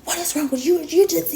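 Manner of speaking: imitating her yelling after me; mock yell. Imitating the driver yelling behind me in the store, high mock yell. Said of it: "yelling, screaming".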